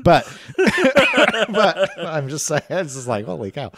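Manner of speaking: slow laughing words. Real, not acted. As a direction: talk through laughter. Laughing at the idea.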